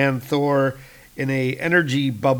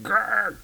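short, high comic growl; mock growl of a wounded giant; angry growl; 9 semitones higher than usual. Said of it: "Galactus is like".

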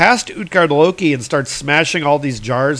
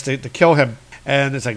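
normal talking voice

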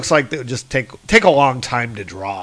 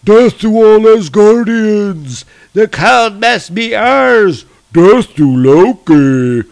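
slow, booming giant roar, much higher and louder; frost giant war cry; 8 semitones higher, 9 dB louder, slower than usual. Acted. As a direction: bellow it like a horde of raging giants. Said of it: "they're smashing through the doors and saying".

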